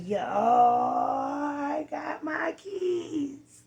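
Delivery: imagine finally holding the keys and crying out; overjoyed announcement. Pretend. Showing viewers her new apartment keys, tearful joy. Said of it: "showing up on here with my keys like".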